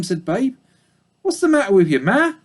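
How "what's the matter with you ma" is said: high, concerned pig voice; kind piglet. Acted. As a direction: ask gently as a young pig. Babe's voice.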